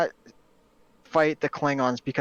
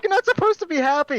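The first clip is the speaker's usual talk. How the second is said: higher, louder mock complaint; imitating Kirk; 13 semitones higher, 4 dB louder than usual. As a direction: protest in a hammed-up Kirk voice. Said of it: "He's like".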